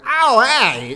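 loud, high quick greeting in a star's voice; Tom Hanks impression. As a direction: toss off a quick celebrity hello. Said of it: "Oh, I can do a great Tom Hanks".

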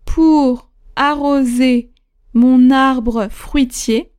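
slow, measured dictation with spoken punctuation; dictating. Shown as a demonstration. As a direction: read each phrase slowly for students to write. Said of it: "c'est parti pour cette huitième dictée". (dictée lente et articulée)

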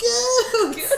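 high, gleeful cheer of the name; playful high-pitched whoop; mock excitement. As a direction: hail it in a silly high voice. Mimicking the celebrated name, laughing.

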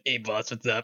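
soft, gruff Italian mobster-style monster; a lightly voiced Italian tough-guy creature. Shown as a demonstration. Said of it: "voicing them with, like, Italian monster voice".

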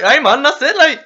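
high, loud, fast Geordie, laughing; excited Geordie. Retold or imitated. Retelling a toy shop customer: excited laughing Geordie. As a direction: squeal it as an excited Geordie woman. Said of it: "an excited Geordie".

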